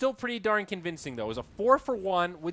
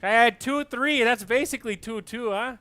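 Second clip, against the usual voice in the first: higher, louder mock Canadian pleading; Canadian accent; 7 semitones higher, 6 dB louder than usual. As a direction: plead like a sore loser Canadian. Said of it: "Canadian argument".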